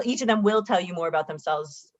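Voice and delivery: ordinary talk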